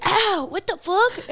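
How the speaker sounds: high, drawn-out cry of pain and outrage; mock pained yelp. Woman imitates man she hit in groin, high pained yelp.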